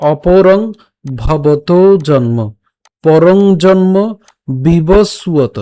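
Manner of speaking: each phrase drawn out and set apart, অপরং on ng; broken-down recitation. Shown as a demonstration. Teacher breaks down the verse, measured phrase-by-phrase recitation. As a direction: say অপরং, pause, then stretch ভবতো জন্ম. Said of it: "শ্লোকটি আরো ভেঙে দিয়েছি".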